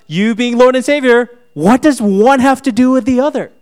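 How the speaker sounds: loud, high exasperated protest to Jesus; retold rant, climbing to a near shout; ticked off. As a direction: argue back in loud, high, incredulous frustration. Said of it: "I was so ticked off".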